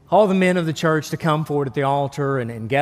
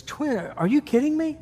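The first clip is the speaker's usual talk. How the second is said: stunned new father; 9 semitones higher, 4 dB quieter than usual. Acted. New father reacts in joke.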